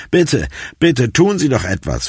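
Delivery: hoch, drängend bittend; flehend. A narrator's reading. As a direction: bettle verzweifelt um Hilfe. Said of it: "flehte ich schließlich". (flehend, mit höherer Stimme)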